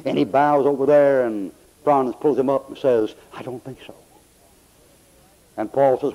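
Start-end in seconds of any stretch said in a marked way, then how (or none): 3.3-3.9 very soft, almost swallowed reply; hushed doubt. Retold or imitated. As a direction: voice sinks away as if too weak to argue. Dying soldier doubts his recovery.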